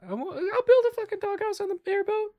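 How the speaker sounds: high, loud, excited outburst. Real, not acted. Player promises the pet dog a doghouse, excitedly high and loud. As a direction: burst out with delight.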